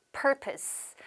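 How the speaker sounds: "Purpose" said softly and slowly with a falling end; model pronunciation. Shown as a demonstration. Teacher modelling "Purpose" for students to repeat. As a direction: say "Purpose" clean, stress on the first syllable. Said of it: "한 번 더".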